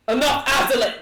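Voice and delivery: loud, high commanding shout. Acted. Strahd shouts at Azalin, commanding and loud. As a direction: stop the rival with a bellow. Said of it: "you hear Strahd's voice".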